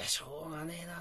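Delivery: usual speaking voice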